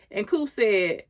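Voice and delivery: usual speaking voice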